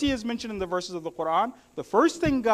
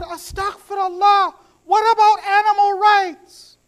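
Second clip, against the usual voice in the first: higher, louder, shocked husband; outraged protest; 9 semitones higher, 9 dB louder than usual. Acted. Husband protests to his wife, loud and shocked. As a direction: cry out in scandalised disbelief.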